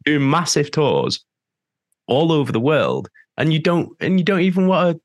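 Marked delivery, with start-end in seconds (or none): 3.4-5.0 high, incredulous venting; indignant, baffled protest; exasperated disbelief. Real, not acted. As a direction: pitch it right up in real exasperation. Venting at unsupportive home press.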